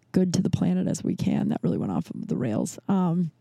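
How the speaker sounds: ordinary talk, nothing marked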